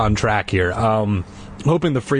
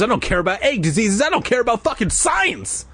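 building rant; 9 semitones higher than usual. Acted. Dana building outburst.

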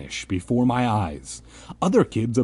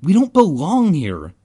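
high, loud protest; crying out; 7 semitones higher, 7 dB louder than usual. A narrator's reading. Ben to the gray circle, crying out. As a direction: cry out in protest. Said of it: "Ben cried".

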